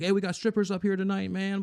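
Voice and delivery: high-pitched imitation of an eager neighbor; excited invitation. Neighbor calls him up to hang out, mock excited.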